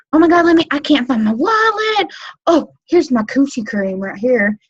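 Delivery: staged fluster at the checkout; flustered gush. Shown as a demonstration. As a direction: play the ditzy search for your wallet. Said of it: "Slow it down".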